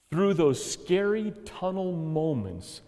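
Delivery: ordinary talk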